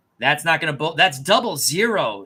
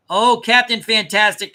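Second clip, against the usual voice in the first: louder, higher call to a barking dog; raised voice to the dog; 7 semitones higher, 9 dB louder than usual. Real, not acted.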